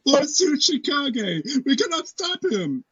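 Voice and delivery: put-on high, slow anime girl plea. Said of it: "some random chick is like".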